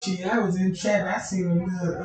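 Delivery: ordinary delivery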